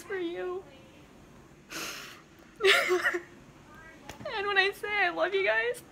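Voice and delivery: choked-up declaration of love; real tears in the voice; crying. Real, not acted. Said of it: "that's why I'm crying".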